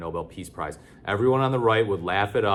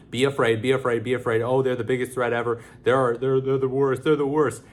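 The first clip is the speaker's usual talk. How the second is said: mock fearmonger; 4 semitones higher, 3 dB louder than usual. Mocking fearmongering propaganda voice.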